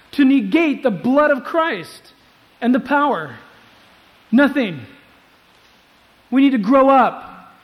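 loud, high, emphatic pulpit delivery; impassioned preacher at full voice; full-force preaching. Acted.

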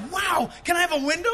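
loud, high, thrilled request; giddy loud ask for a window seat; excited shout. Retold or imitated. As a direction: blurt it out like a kid at a candy store. Retells upgrade at check-in.

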